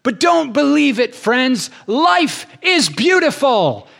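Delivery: high, loud rock-singer shout, slowing on the last line; singer's shout. Retold or imitated. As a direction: belt it over a roaring band. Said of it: "he goes".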